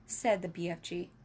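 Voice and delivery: plain reading voice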